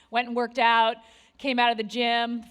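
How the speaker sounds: ordinary talk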